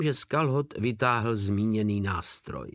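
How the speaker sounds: ordinary delivery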